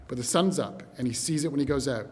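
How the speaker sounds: ordinary talk, nothing marked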